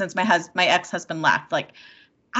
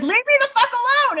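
high, sharp mock snap at the ex; angry retort; faster than usual. Woman retells telling her ex off, angrily. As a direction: fire it back, fed up. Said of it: "I'll be like".

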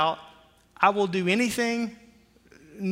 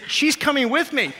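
cocky; 9 semitones higher, 5 dB louder than usual. Retold or imitated. Retold cocky answer about wife.

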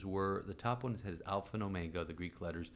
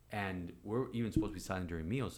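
normal talking voice

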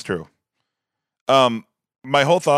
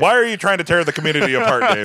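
higher, louder mock-indignant accusation; mock outrage; 8 semitones higher, 6 dB louder than usual. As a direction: play scandalised, half joking. Mock outrage at cake debate.

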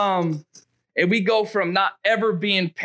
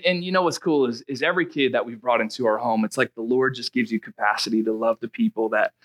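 normal talking voice

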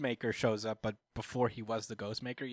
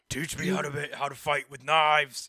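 loud, higher-pitched comic demand; demanding Batman; 3 semitones higher, 6 dB louder than usual. Retold or imitated. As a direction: play the demanding hero for laughs. Batman demands training in retelling.

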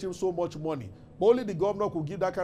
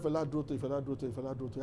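musing repetition; 4 semitones lower, 7 dB quieter, faster than usual. Retold or imitated. Governor puzzles over a half-remembered name.

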